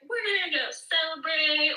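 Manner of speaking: high, excited cheer at ordinary volume; imagined celebrating parent. Pretend. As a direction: play a parent announcing a celebration. Voices her imagined celebrating parent.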